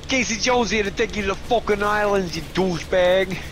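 put-on high, loud Brooklyn accent; brash Brooklyn tough guy accent. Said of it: "a very stereotypical Brooklyn accent".

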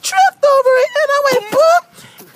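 high-pitched, worked-up character voice; complaining cartoon character; rowdy aggrieved Francis. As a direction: tattle indignantly in a high puppet voice. Francis tells how she was tripped.

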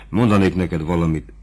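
plain reading voice

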